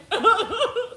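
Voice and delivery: high, loud laugh. Real, not acted. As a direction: laugh out in amusement. Laughing about pumping milk.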